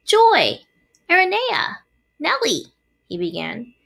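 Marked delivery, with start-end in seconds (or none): 0.0-2.7 high, louder ceremonial address; speech opening. A narrator's reading. Wilbur begins his speech to the spiders, proclaiming. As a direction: call each name high, with importance. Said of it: "he should make a short speech".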